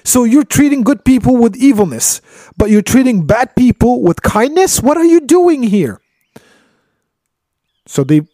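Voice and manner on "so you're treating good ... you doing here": incredulous protest. Retold or imitated. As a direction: demand an explanation in disbelief. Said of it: "at Khidr and is like".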